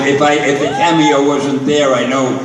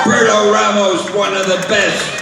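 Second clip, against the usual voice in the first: announcer shout; 7 semitones higher than usual. Acted. Stan echoes the announcement.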